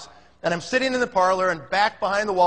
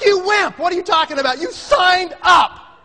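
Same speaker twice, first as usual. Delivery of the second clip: much higher, loud exasperated yell; mock outrage; 11 semitones higher, 7 dB louder than usual. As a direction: blow up at the cowardly friend. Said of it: "I was like".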